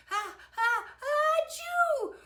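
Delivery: very high, loud achoo; acted sneeze. A narrator's reading. Victor meets the pepper dragon, acted sneeze. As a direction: wind up into a huge fake sneeze. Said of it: "he sneezed".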